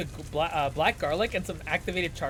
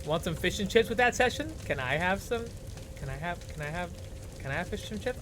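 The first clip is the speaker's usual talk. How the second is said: ordinary delivery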